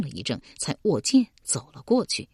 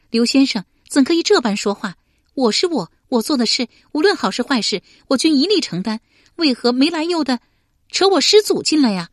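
高声怒喝，越说越响; 气冲冲地质问对方; 动气喝问; 8 semitones higher, 6 dB louder than usual. A narrator's reading. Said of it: "喝道". (佩娘动了气，高声喝问刘玲)

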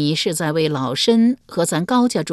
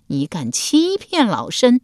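higher, slow, forceful scolding; angry rebuke; 6 semitones higher, 4 dB louder, slower than usual. A narrator's reading. Empress dowager slamming the desk at her lying nephew, angry rebuke. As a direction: rebuke them with drawn-out indignation. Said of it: "斥道".

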